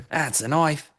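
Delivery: mock Australian accent. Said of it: "it's still an Australian accent".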